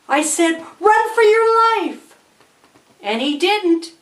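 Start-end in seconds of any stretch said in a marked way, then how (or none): 0.8-2.2 high, drawn-out cry of warning; urgent shout. Acted. Storyteller warning boy to flee, high urgent shout. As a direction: shout it high, stretching the words.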